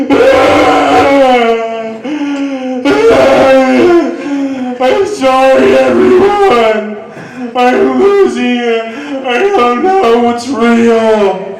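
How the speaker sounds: loud, high hammed-up wailing; mock crying. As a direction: wail it like a man melting down on the floor. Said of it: "And then he starts crying".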